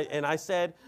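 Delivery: normal talking voice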